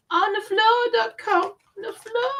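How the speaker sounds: high playful singsong repeat; comic singsong. Real, not acted. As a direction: sing out the joke.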